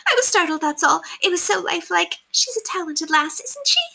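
high, squeaky servant explaining herself; squeaky housemaid. A narrator's reading. Housemaid explaining her shriek to governess, squeaky. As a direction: chirp the excuse in a high squeak. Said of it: "with her high voice squeaky as a fiddle".

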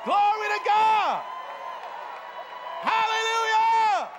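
high, drawn-out holler; exultant praise shout. Real, not acted. Preacher rousing the congregation to praise, exultant shout.

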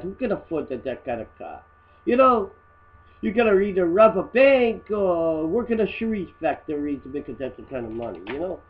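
normal talking voice

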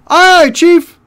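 very high, loud squeal; shrieked minion reply; eager underling. Acted. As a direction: squeak back to the boss. Underling acknowledges an order.

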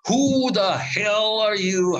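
high, slow, raging challenge; mock rage at an intruder; angry retelling. Sculptor raging at reporter on his mountain, angry. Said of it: "he comes at me in a rage".

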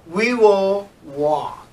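slow, careful we will walk; slow model reading. Shown as a demonstration. Teacher models the replacement sentence slowly. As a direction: say it slowly for the class. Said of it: "you can say".

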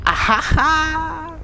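high gleeful burst of laughter; loud triumphant cackle. Real, not acted. Celebrating a kill, laughing.

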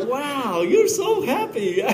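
high, slow, laughing wow; laughing mock admiration. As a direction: mimic an admirer while laughing. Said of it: "It's like".

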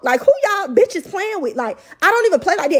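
real outrage spilling out; angry rant. Real, not acted. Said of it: "I'm so motherfucking mad".

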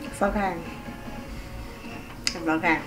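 ordinary delivery

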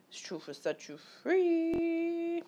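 slow, high preachy proclamation; a hint of a preacher declaring; mock sermon. Solemn proclamation of truth.

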